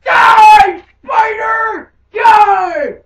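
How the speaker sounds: high, loud battle cry; screaming at a spider. Pretend. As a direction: scream as you attack. Attacking door spider.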